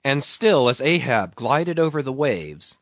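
ordinary delivery, nothing marked

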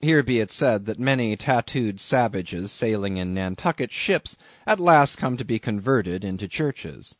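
plain reading voice

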